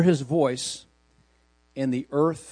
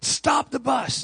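retold scream; 10 semitones higher, slower than usual. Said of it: "he screams out to the bus driver".

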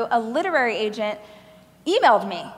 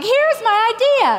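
eager pitch; 11 semitones higher, 5 dB louder than usual. Retold or imitated. Eager naive pitch to agent.

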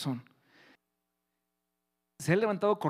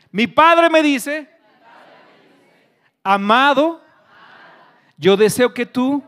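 high, very slow shouted proclamation; loud declaration; 8 semitones higher, 15 dB louder, much slower than usual. Real, not acted. Preacher feeding "Mi padre me dice" to congregation, loud declaration. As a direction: call out each phrase for repeating. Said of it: "Repite eso fuerte conmigo".

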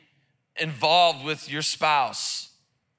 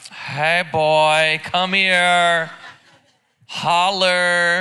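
loud, flirty call to her man; mock wife hollering for her husband across the house; wife calling husband over; 6 dB louder, much slower than usual. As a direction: beckon him over, loud and playful. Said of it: "she like".

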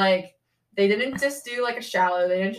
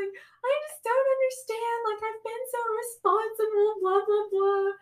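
much higher, slow, tearful complaining; tearful whine; 14 semitones higher than usual. Retold or imitated. Telling the nurse after a positive test, high tearful whine. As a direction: whine it through mock tears. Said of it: "I start crying".

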